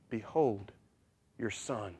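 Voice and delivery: low, very soft, halting words; faint, dying tenderness; hushed farewell. Acted. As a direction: speak softly and low, with pauses. Dying Jesus speaks to his mother.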